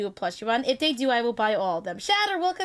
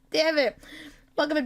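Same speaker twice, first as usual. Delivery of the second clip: ordinary talk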